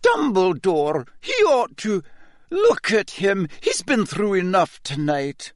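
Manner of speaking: high-pitched, anxious objection; pleading protest. Acted. McGonagall protests to Dumbledore for Harry, pleading.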